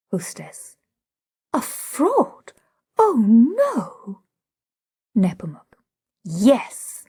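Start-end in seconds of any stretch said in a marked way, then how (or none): 1.6-2.5 high, disbelieving question; shocked. A narrator's reading. Hostess hearing Nepomuk call the girl a fraud, high shocked question. 3.0-4.2 high little cry of dismay; a pained protest. A narrator's reading. Hostess refusing to believe the girl is a fraud, high dismayed cry.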